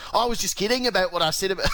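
high, fast Rory impression slipping into Australian; mock Australian accent. Golf podcaster mocking Rory McIlroy, hammed-up Australian accent. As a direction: rattle it off in a jokey Aussie twang. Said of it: "I don't know why he's Australian there".

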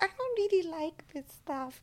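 high, soft, thin-volume put-on voice; imitated unimpressed cousin. Cousins turn down the film, mock high soft refusal. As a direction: go high and quiet, mimicking a reluctant relative.

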